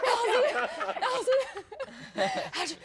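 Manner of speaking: high, breathless excited mimicry; out of breath. Nick runs up after the table read, out of breath. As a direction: imitate someone panting after running up. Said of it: "he was really out of breath".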